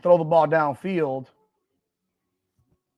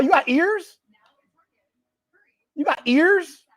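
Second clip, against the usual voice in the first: high-pitched, incredulous question; indignant challenge; 8 semitones higher, 3 dB louder, slower than usual. Real, not acted. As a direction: throw it back at the critic, voice raised in pitch. Snapping back at a listener.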